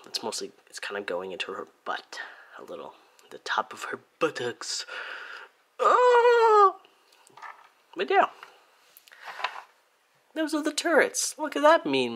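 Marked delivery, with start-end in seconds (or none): 5.8-6.8 loud, very high drawn-out "ah"; sudden piercing held cry. Real, not acted. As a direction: let out a long high squeal. Sudden cry mid-demonstration.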